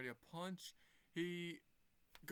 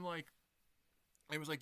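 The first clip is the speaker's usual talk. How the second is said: ordinary talk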